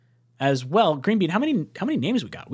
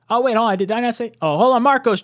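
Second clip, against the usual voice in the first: higher and louder, a sudden interruption of himself; sudden surprise; 8 semitones higher, 6 dB louder, faster than usual. Real, not acted. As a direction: stop mid-sentence, caught off guard. Suddenly notices new channel member.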